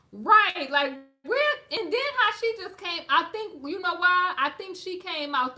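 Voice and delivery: high, loud, worked-up ranting; excited. Real, not acted. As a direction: fire off the points, all worked up. Excited rant after laughing.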